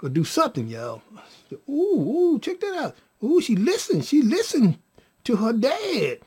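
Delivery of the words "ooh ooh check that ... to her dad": high, swooping excited squeal; delighted amazement. Retold or imitated. Baby mother feels the baby respond, amazed. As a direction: imitate a thrilled mother, pitched way up.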